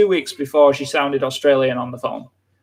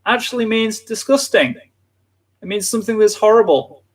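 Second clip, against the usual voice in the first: emphatic explanation; 7 semitones higher than usual. Real, not acted. Explaining dialect word.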